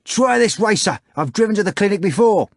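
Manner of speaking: high, fast hawking yell; cabby's shout. A narrator's reading. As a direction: call out for a fare, high and eager.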